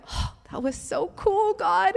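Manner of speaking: high, excited marveling; awed delight. Retold or imitated. Telling God after praying for her, awed excitement.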